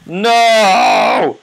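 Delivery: high, loud anguished "No!"; desperate shout. Real, not acted. Gamer shouting as his character dies, anguished.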